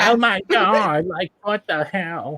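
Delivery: put-on slow, high Valley Girl drawl. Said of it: "use a Valley Girl voice".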